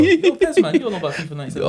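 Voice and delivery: high loud laughing taunt; laughing tease. Real, not acted. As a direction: cackle the taunt out. Teasing, laughing.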